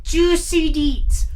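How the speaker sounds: slow, high, drawn-out gossipy tease; gossipy relish. Pretend. Co-host teasing the story to come, gossipy relish.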